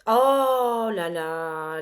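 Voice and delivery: shocked oh là là. Real, not acted. Tarot reader gasping at the falling card, drawn-out dismay. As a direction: stretch it out in alarm.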